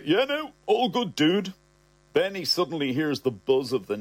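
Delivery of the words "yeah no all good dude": higher, breezy reassurance; Ross brushing it off. Acted. Ross to his nervous dad: breezy higher reassurance. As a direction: play it cool and casual.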